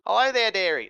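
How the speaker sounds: high, loud sing-out greeting; night hag, first attempt. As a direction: trial hag voice, pitched high. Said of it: "No, that's not that".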